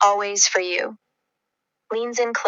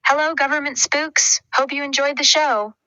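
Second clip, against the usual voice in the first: higher-pitched, enthusiastic hello with a grin; enthusiastic greeting; 6 semitones higher than usual. Acted. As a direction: greet them brightly, pitched well up. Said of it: "Waves enthusiastically at the camera, grinning broadly".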